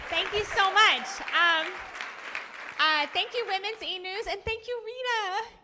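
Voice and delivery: loud and high, bubbling with thanks; excited thanks. Real, not acted. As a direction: gush your thanks at the crowd. Thanking the host on stage.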